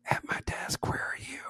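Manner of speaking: hushed unvoiced whisper; whispering back. Officer whispering back to the secretary, hushed. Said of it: "I whisper back".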